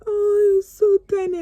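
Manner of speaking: cooing strangers. Retold or imitated. Shoppers coo over her tiny baby, high cooing. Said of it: "everybody was like".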